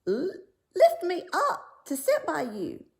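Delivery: high, loud frog voice; the frog calling up to be lifted; frog crying out. Acted. Frog asking the princess to lift him, high and loud. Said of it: "Then he stopped and cried".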